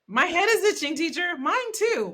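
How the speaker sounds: put-on high-pitched kids clamoring to teacher; mock schoolchild complaint; whiny little pupils. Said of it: "Everyone's like".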